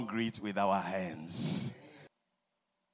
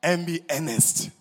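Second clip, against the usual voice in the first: thundering preacher's summons; fiery exhortation; 11 semitones higher, 12 dB louder than usual. Acted. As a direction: rouse the saints to work. Preaching at full force.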